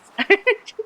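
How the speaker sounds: can't hold back the giggle; high giggle. Real, not acted. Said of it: "I cannot say gobbler with a straight face".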